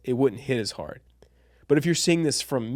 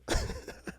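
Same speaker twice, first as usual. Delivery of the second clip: breathy silent laugh; 10 dB quieter than usual. Real, not acted. Quiet laugh after story.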